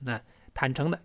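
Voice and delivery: normal talking voice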